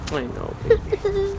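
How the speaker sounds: soft high reassuring coo; tender. Real, not acted. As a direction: comfort her gently, softly. Father comforting sad daughter.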